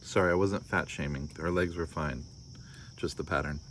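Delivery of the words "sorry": ordinary delivery, nothing marked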